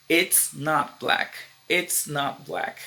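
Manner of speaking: slow, "It's" clearly joined; slow contraction demo. Shown as a demonstration. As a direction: model the short form slowly for learners. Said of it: "vai ser contraction".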